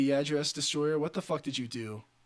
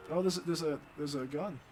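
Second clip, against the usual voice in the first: ordinary talk, nothing marked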